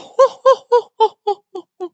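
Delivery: high, loud staccato ho-ho laugh; character laugh. Acted. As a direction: belt out a long, high cartoon laugh.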